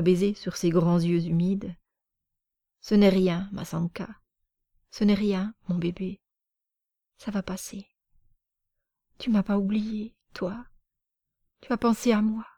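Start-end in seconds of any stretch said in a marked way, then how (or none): 2.8-12.5 tender murmur. Acted. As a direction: console a child gently. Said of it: "Et il murmura".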